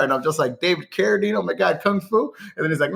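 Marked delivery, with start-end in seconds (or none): 0.6-2.3 mock astonishment, pitched higher; higher-pitched imitation of shocked realization; retold disbelief. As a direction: re-enact a starstruck, alarmed reaction. Stunned reply to valet in retold scene.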